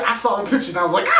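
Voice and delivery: words pushed out fast through laughter; racing, laughing recollection; laughing while telling. Real, not acted. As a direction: giggly rush of words. Laughing at the awful picture.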